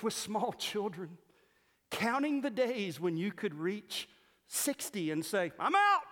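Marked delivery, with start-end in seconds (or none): 5.6-6.0 high, loud gleeful "I'm out"; mock retiree quitting. A retired church member gleefully quitting, high and loud. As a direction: celebrate being done at last.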